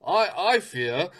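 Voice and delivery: a posh-ish British gentleman arguing politely; playfully imitating a civil British debater; mock British argument. Friend mocks polite British arguers, lightly imitating British accent. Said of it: "They'll be like".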